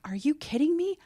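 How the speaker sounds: high-pitched retold disbelief; retold outrage. Patient recalling her doctor's reply, retold outrage. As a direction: re-enact your indignant reaction, pitched right up. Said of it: "I got so angry".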